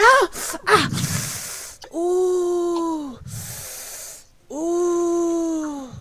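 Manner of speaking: high little yelps and oohs; fumbling with things, startled cries; flustered yelps. Acted. Halfling woman fumbling behind the door, yelping.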